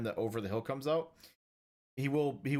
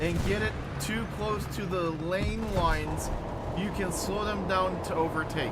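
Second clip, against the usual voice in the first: slower, higher Southern drawl; 5 semitones higher than usual. Shown as a demonstration. Repeating the race tip slowly in a Southern drawl. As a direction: stretch it out in a thick Southern drawl. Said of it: "This isn't English".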